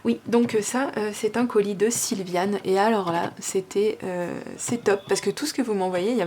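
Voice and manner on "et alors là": ordinary talk, nothing marked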